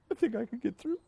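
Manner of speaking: fighting back sobs, pitch cracking up; crying. Real, not acted. Pastor weeping while thanking his church, voice breaking. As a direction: voice breaking high through tears. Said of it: "I'm like a child when I cry".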